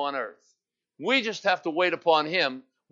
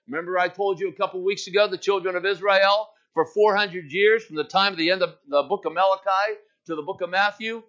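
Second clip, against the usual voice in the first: ordinary delivery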